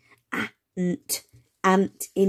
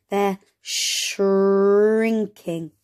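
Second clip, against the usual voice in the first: drawn out very slowly; slow, stretched word; much slower than usual. Shown as a demonstration. Teacher drawing out the word shrinking, very slow. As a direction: stretch the new word out for the kids. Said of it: "Do you remember that word?".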